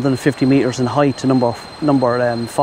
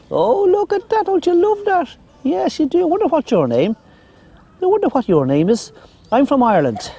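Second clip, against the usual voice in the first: very high sing-song pet voice; talking to a dog; 8 semitones higher than usual. Real, not acted. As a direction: coo way up high at the little dog. Baby talk to a dog.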